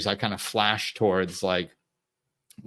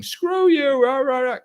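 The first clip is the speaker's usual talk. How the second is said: much higher, louder hammed-up rant; mock angry caller; 6 dB louder, slower than usual. The caller cursing at the speaker, in a high mock rant. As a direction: squeal out a cartoon tantrum. Caller still yelling, mocked.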